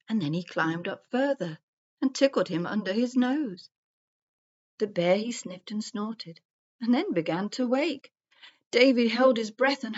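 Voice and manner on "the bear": plain reading voice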